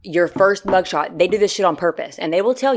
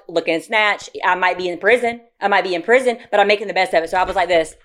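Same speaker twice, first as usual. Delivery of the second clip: usual speaking voice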